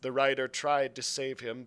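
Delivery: plain reading voice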